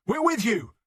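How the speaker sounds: high, loud desperate shout. A narrator's reading. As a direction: shout it across a battlefield to stop an attack. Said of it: "he shouted".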